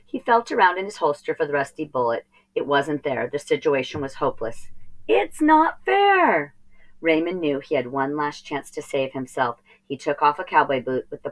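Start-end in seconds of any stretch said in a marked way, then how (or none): 5.1-6.5 high, slow sulky whine; whiny complaint. Acted. Boy sinks into the sea, whining high and slow. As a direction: stretch it out like a sulking kid.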